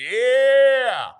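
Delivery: long, high, loud whoop; elated cheer. Real, not acted. Host cheering for football soon, elated. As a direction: belt out one drawn-out cheer.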